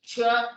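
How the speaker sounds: เฉิบ with a loud, high, hissing sh; sh sound demo. Shown as a demonstration. Teacher showing the sh sound to the class, loud and high. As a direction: push out เฉิบ as a sharp sh. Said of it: "เฉิบอันแรกเป็น เอสเอช".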